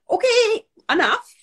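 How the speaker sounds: high, loud mock-exasperated cutoff; playful "that's enough" pushed up high and loud; acted intervention. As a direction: call a friend out of wallowing. Friend calling time on wallowing.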